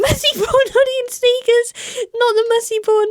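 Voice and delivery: very high, hammed-up squeal; mock horror. Reader mocking the writer's look down at herself, mock horror. Mock shock at messy bun outfit.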